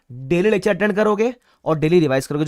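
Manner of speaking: ordinary delivery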